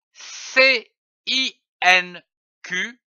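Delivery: slow letter-by-letter spelling, C I N Q; spelled out slowly. Shown as a demonstration. Teacher spelling the word slowly for children. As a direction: spell each letter slowly for young readers. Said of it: "je lis une syllabe".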